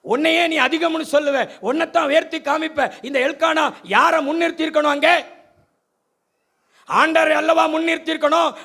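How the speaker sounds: fiery preaching. Acted. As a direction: drive the point home from the pulpit. Preaching at full force.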